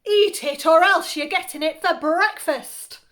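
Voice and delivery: loud, high-pitched scolding from Mum; angry snap. A narrator's reading. Mum to child refusing cabbage, angry loud snap. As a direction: snap it out loudly as an ultimatum. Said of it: "My mum snapped".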